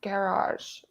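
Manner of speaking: garage said as GARE-ahzh; English-style garage. Retold or imitated. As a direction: stress the first syllable of garage, ending in a soft zh. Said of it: "she says garage or garage".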